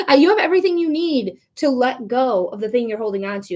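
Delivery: usual speaking voice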